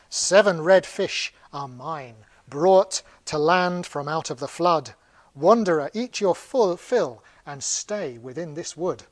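slow, measured verse reading; stately recital, each line spaced out; poem recitation. Acted. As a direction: declaim the verse slowly and deliberately. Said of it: "I'll read out the, um, there are little poems".

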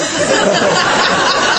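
an airy, high giggle; high breathless laugh. Real, not acted. Laughing as he asks the team not to mimic him.

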